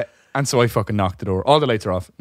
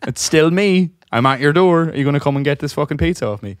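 louder, higher, fed-up driver on the phone; exasperated; 7 semitones higher, 7 dB louder than usual. Retold or imitated. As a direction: let the annoyance burst out at the customer. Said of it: "I'm like".

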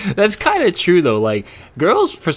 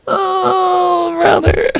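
exasperated; much slower than usual. Real, not acted. Streamer reacts to creepy character.

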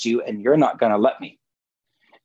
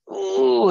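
high delighted ooh. Real, not acted. Enjoying the deeper twist.

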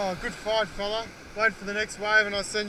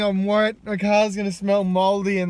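ordinary delivery

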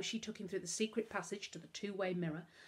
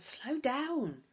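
calling out; 8 semitones higher than usual. A narrator's reading. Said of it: "she called out".

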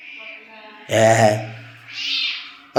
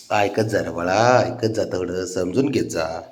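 softer, slower, lower, gently pleading with his little one; tender coaxing; 7 semitones lower, 7 dB quieter, slower than usual. Real, not acted. (बाळाला हळू, मृदू आवाजात समजावणे)

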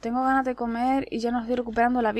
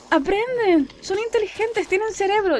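high-pitched disbelief; astonished; 9 semitones higher, 3 dB louder than usual. Real, not acted. Player finding the little enemies can learn, astonished. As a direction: exclaim each discovery in amazement.

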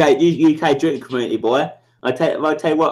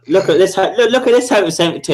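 louder and higher, taunting Heavy Seventy-two; taunting call-out; 6 semitones higher, 5 dB louder than usual. Real, not acted. Streamer taunts a rival in chat, loud higher call-out. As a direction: call him out, getting louder.

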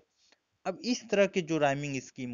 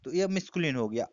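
normal talking voice

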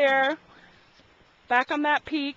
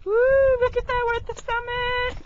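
excited; 11 semitones higher than usual. Real, not acted. Excited at reaching summit.

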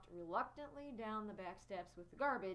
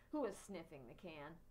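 plain reading voice

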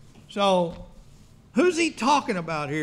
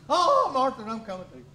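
higher, louder mock heart-attack cry; Fred Sanford; 7 semitones higher, 4 dB louder than usual. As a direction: fake dying wail to Martha. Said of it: "kicked like Fred Sanford".

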